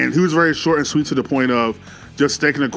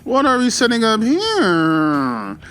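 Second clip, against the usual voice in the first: slow, drawn-out wondering hum; puzzled musing; much slower than usual. Retold or imitated. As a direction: muse it over, slow and suspicious. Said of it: "And I'm like".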